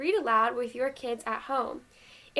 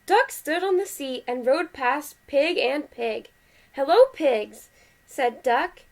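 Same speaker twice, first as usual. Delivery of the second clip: lively, measured picture-book reading; animated read-aloud with well-marked phrases; story read to children; 4 dB louder, slower than usual. A narrator's reading. Parent reading Duck on a Bike to kids, animated story voice.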